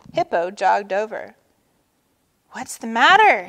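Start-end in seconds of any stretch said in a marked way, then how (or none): 2.5-3.5 louder, alarmed call; crying out. A narrator's reading. Hippo jogs over to check on Turtle, crying out. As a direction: cry out with concern. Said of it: "he cried".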